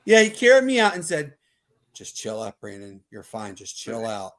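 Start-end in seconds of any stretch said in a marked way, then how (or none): 1.9-4.3 soothing. Retold or imitated. Friend calming him after fight.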